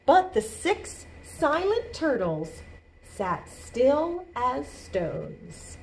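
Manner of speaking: measured storybook read, slowing down; slow picture-book narration; reading to children. A narrator's reading. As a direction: read slowly, lifting the key words. Reads picture book aloud.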